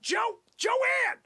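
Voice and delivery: high-pitched call across the office; yelling. Acted. Boss calls his number two Joanne, yelling. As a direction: holler for your assistant. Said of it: "I gotta yell at my friggin' number two".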